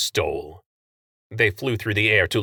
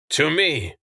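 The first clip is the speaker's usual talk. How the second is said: calling out; 7 semitones higher than usual. A narrator's reading. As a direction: call the line forward. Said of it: "Telford called".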